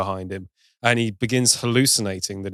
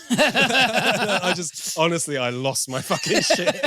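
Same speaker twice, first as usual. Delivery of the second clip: higher-pitched, talking through real laughter; laughing; 7 semitones higher, slower than usual. Real, not acted. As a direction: crack up while getting the words out. Cracks up retelling the scene.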